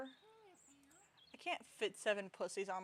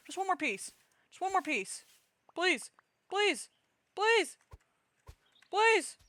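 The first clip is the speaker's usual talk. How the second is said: pleading, rising higher and louder; desperate begging; 11 semitones higher, 11 dB louder, slower than usual. Retold or imitated. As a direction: beg harder with every please. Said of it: "I'm like six pieces later".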